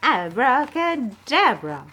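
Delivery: slow, loud magic word; magician's incantation. Acted. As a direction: draw out the spell.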